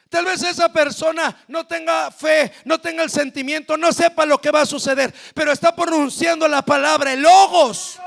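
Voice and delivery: loud, building sermon; fervent preaching. Acted. Predicación encendida. (predicación encendida y fuerte)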